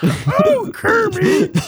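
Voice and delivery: hammed-up Piggy swoon; Miss Piggy voice. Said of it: "sounds like Sesame Street".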